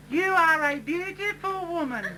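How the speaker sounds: mock-sweet pupil; flattering schoolgirl. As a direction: coo the compliment up at her. [name] flatters teacher.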